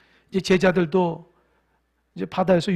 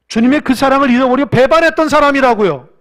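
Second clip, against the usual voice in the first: preaching at full force; 5 semitones higher, 13 dB louder than usual. Acted. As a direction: preach it with a catch of tears in the voice. Sermon climax. (열정적으로 외치는 설교)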